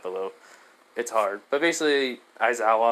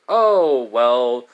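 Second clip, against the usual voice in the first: loud exclaim; 8 dB louder than usual. Acted. Hasashi blurting out to the injured boy, loud.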